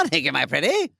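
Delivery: a hint of a cackling wicked witch; lightly voiced storybook witch menace. Host voicing the witch bee, light witch voice.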